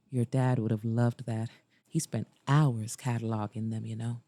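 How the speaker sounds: low, subdued male voice; gentle Mason character. A narrator's reading. Mason to Liza about her dad's records, gentle low voice. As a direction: pitch down for the man's fond recollection.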